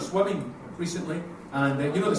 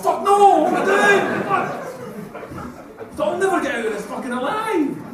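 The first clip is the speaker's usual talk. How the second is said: much higher, louder panicked thought; mock panic; 13 semitones higher, 7 dB louder, slower than usual. Dad panicking in the changing room, hammed-up high alarm. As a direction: panic in your head, high and loud. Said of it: "And I thought".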